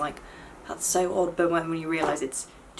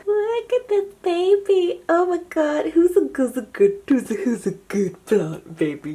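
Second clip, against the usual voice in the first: talking to a plant; 9 semitones higher, 8 dB louder, slower than usual. Real, not acted. Cooing over a thriving plant.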